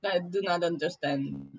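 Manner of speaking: put-on careful, formal Russian-accented words. Merfolk puzzled by the German guess, Russian accent. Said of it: "The accent".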